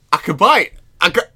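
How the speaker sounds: high, loud burst of mock excitement; excited realization. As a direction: act out suddenly finding you can afford it. Acts out finding money in wallet.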